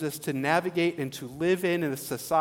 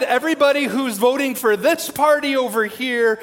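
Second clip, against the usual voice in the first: louder, higher pretend command; mock announcement; 6 semitones higher, 7 dB louder than usual. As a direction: play the pastor sorting the room. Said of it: "What if I said".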